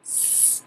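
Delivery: "S," as a soft long hiss; teacher isolating the s sound; isolated s sound. Shown as a demonstration. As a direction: hiss the "S," quietly. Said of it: "first sound you hear".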